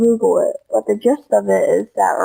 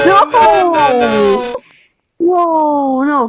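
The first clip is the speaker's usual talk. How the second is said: shouting; 6 semitones higher, 4 dB louder, much slower than usual. Real, not acted. Protesting the kick vote.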